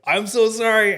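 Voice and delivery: high, loud mock apology; retold pleading apology. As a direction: blurt out a hammed-up sorry. Retold apology to furious colleague.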